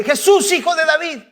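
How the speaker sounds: high-pitched call for attention; crying out. Retold or imitated. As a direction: call out high, as to someone passing. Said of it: "empieza a gritar".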